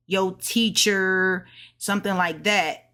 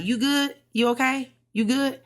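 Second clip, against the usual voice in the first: casual check-in; 6 semitones higher than usual. Acted. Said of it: "go by somebody".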